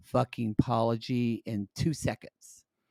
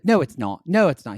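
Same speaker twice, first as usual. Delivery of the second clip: firm denial; 8 dB louder than usual. Retold or imitated. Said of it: "She said".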